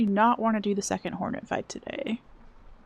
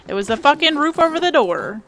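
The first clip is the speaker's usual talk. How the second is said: louder and higher, exasperated; frustrated outburst; 5 semitones higher, 10 dB louder than usual. Real, not acted. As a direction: yell it at the screen in frustration.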